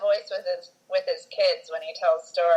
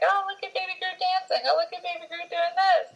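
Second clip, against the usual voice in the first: put-on much higher, cooing over Baby Groot; gushing fan voice; 11 semitones higher than usual. Marketing made everyone gush over Baby Groot, high cooing. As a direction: coo like fans gushing at a cute baby. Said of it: "Baby Groot being like".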